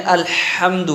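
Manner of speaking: الحمد slowly, with a forceful ح from mid-throat; pressed ha. Shown as a demonstration. Teacher models it for students, pressing the ha. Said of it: "درمیان حلق پر زور".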